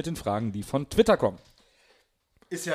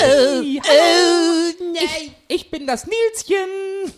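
squeaky cute voice; 13 semitones higher, 5 dB louder, much slower than usual. Acted. Cutesy diminutive intro.

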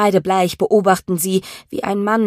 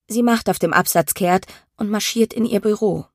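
ordinary delivery, nothing marked

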